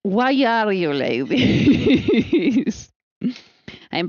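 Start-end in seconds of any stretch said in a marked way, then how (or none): 0.0-2.9 slow, drawn-out question through laughter; laughing at the roommate; laughing disbelief. Real, not acted. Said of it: "laughing exasperation".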